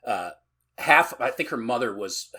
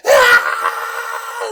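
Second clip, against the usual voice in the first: put-on loud, low shriek of panicked children; terrified kid scream; 7 semitones lower, 14 dB louder than usual. Kids hearing El Cucuy is coming, loud terrified scream. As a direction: let out a frightened crying yell. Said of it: "And they're like".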